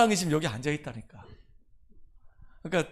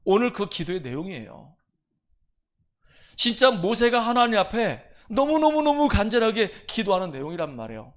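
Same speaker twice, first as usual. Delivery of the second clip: ordinary delivery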